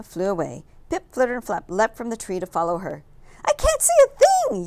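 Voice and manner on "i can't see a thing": very high, loud panicked yell. A narrator's reading. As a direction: yell blind in the dark. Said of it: "yelled Pip".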